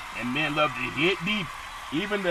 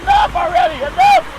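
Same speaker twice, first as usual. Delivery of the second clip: much higher, louder yelp to stop; mock cutoff yell; 10 dB louder than usual. Host stops his own singing with a squeaky shout. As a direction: shriek to shut himself up.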